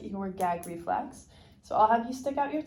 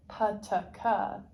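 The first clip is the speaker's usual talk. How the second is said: slow, deliberate pataka; slow pataka demo; slower than usual. Shown as a demonstration. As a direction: spell out pataka slowly.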